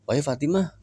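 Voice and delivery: lightly voiced father asking his crying daughter; gently asking father. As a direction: voice the father's worried question. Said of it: "kata Kanjeng Rasul".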